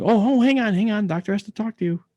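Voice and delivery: high, loud urgent secretary; flustered secretary. Retold or imitated. Secretary putting the patient through to doctor, flustered. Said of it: "I get the secretary".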